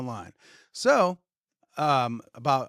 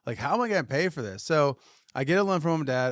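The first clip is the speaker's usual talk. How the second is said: ordinary talk, nothing marked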